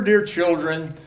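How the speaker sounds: ordinary delivery, nothing marked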